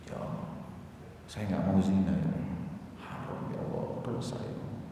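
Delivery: acted near-whispered prayer to God; hushed plea. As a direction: plead under your breath, as the tempted man. Frightened man's plea to God, acted.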